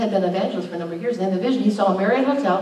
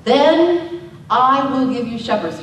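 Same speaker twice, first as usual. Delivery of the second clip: slow scripture reading; 3 semitones higher, 5 dB louder, much slower than usual. Acted. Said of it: "It says".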